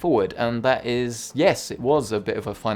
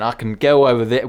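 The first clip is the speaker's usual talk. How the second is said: usual speaking voice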